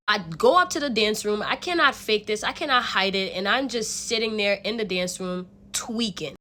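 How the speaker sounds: normal talking voice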